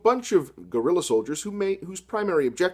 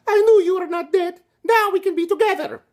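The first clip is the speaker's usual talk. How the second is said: much higher, louder falsetto heroine; Maria falsetto; 5 dB louder than usual. Acted. Maria gushing to Robert in a high falsetto. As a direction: swoon like a melodramatic heroine.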